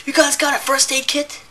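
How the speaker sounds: imitating a guest in a high voice; a lightly mocked clueless guest asking. Guest quoted, much higher.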